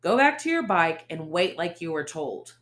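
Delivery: loud dismissive command; stern order. Acted. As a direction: send her away, hard. Ace orders her away.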